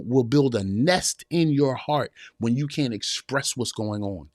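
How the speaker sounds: usual speaking voice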